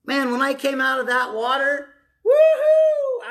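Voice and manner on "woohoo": high, loud whoop of joy; joyful whoop. Retold or imitated. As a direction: whoop it out like coming up from baptism. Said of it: "I was screaming across the river".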